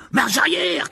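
loud, high urgent cry; urgent high yell; shouted order. A narrator's reading. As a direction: shout it as an emergency command. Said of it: "cria Harry".

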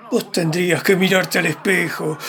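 ordinary delivery

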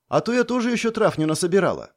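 put-on quick eager girl's chatter; eager fast. A narrator's reading. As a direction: rush it out, keen. Lana continues eagerly. (быстро, с азартом)

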